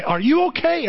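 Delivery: high, concerned question; worried father. Retold or imitated. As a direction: check anxiously on your son, pitched high. Father worried after son's crash.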